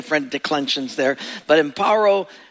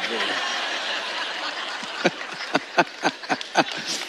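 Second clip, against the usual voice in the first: laughter; 6 semitones lower, 7 dB quieter than usual. Real, not acted. Audience laugh after punchline.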